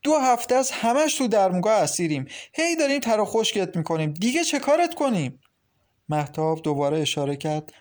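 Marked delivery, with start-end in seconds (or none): 0.0-5.4 raised, high-pitched exasperated outburst; loud voice. A narrator's reading. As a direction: let the pent-up grievance out loudly. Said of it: "با صدای بلندی گفت". (با صدای بلند)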